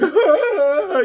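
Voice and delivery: very high cry broken by a laugh; mock the old man's grief through laughter; laughing wail. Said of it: "the guy's like".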